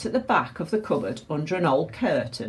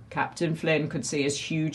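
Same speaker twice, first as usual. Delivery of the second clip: usual speaking voice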